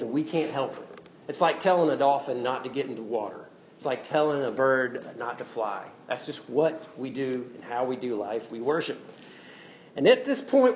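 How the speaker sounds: usual speaking voice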